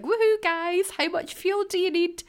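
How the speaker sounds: excited cheer. Retold or imitated. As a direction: whoop it up like handing out free money. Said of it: "I'd be at Tesco's like".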